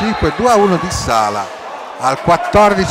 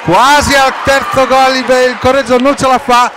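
rising, louder play-by-play shout; excited commentary; 6 semitones higher, 5 dB louder than usual. Real, not acted. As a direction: call the chance with mounting excitement. Live play-by-play near goal.

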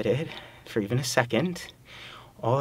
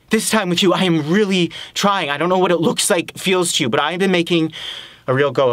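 raised pitch, insistent and urgent; impassioned pleading; 4 semitones higher than usual. Acted. Man insists to partner that he is trying, impassioned. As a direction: push to be believed, stumbling over words.

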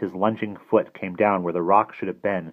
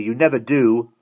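louder, higher defiant jab; 5 semitones higher, 7 dB louder than usual. A narrator's reading. Simon answers the villain, defiant. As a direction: call it back defiantly. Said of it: "Simon called defiantly".

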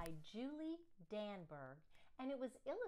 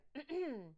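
throat clear; 5 semitones higher than usual. Acted. Principal clearing her throat before the class. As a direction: clear your throat for attention. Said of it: "cleared her throat".

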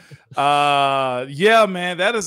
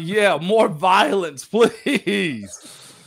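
imitating Dolly; 3 semitones higher, much slower than usual. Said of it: "Dolly's like".

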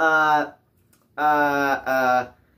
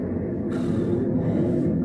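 weary sigh. Real, not acted. Tired of delays.

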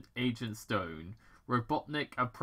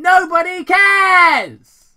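huge, much higher slow "Nobody cares!"; loud shout; 21 dB louder, much slower than usual. Acted. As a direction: blast it at him. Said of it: "Robotnik interrupted loudly".

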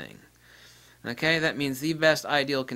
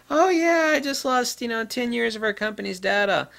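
much higher, airy mock voice; mock careless user; 10 semitones higher, 4 dB louder than usual. Mocking a user who shrugs off lost company data. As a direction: imitate a clueless shrug over lost data.

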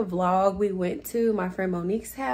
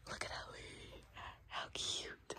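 whisper; 25 dB quieter than usual. Real, not acted. Whispering over the baby.

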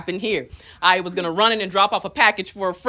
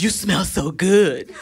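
put-on slow, creepy drunk murmur; drunk guy; 2 semitones lower, much slower than usual. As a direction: savor each word like a drunk. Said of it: "He goes".

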